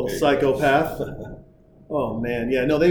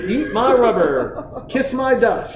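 high, drawn-out mock taunt; Clark; 7 semitones higher, slower than usual. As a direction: gloat at the driver you're overtaking. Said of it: "He's like".

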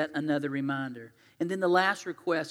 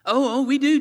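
high, loud, over-keen outburst; eager interjection; 8 semitones higher, 11 dB louder than usual. Acted. Disciples rushing to answer Jesus, eager and loud. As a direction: jump in like an eager volunteer.

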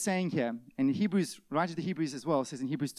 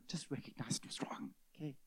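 whisper; 13 dB quieter than usual. Acted. Sudden whisper.

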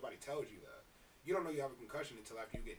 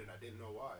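normal talking voice

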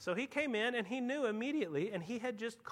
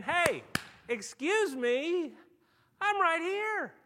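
loud, higher, slowed call to attention; 9 semitones higher, 8 dB louder, much slower than usual. Acted. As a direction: call them back loudly, pointing to yourself. Jesus answering panicked disciples.

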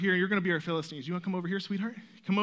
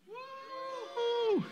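squeaky high whoop; a quick, high cheering woo; 4 dB quieter than usual. Shown as a demonstration. Prompting the kids playing Israel, a high woo. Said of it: "kinda react to that".

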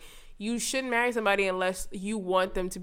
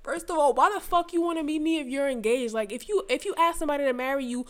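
put-on high, indignant retelling of an angry girl; retold pissed-off rant; 5 semitones higher, 5 dB louder, faster than usual. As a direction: act out her outraged tirade, pitched up and building louder. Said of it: "And she was pissed".

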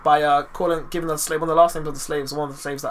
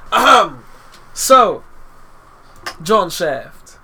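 mock grand narration; 2 semitones higher, 8 dB louder, much slower than usual. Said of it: "Okay, I'm gonna stop now".

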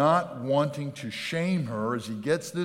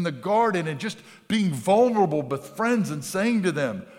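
louder, higher impassioned preaching; preaching at full force; 4 semitones higher, 5 dB louder than usual. Acted. Pastor preaching passionately to his congregation. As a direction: pour it out from the pulpit.

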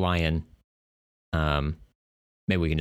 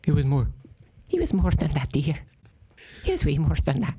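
high prim nanny voice; Mrs. Doubtfire voice; 6 semitones higher than usual. Acted. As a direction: insist like a fussy old nanny. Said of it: "a Mister Missus Doubtfire type of voice".

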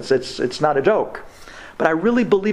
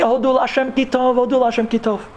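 high, ecstatic exclamation, mildly raised voice; mock joyous scream, pitched up; imitated joyful screaming; 8 semitones higher than usual. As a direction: cry out praise in retold elation. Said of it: "I was screaming".

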